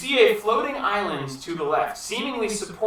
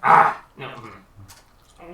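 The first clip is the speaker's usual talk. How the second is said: kobold voice; 6 semitones lower, 7 dB louder, much slower than usual. Acted. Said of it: "I'm trying not to do the goblin voice".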